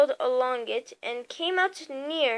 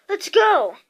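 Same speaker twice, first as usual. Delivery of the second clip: raised, hurried urgent call; 6 semitones higher, 6 dB louder than usual. Acted. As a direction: push them to move now. Urgent escape.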